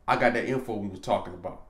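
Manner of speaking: usual speaking voice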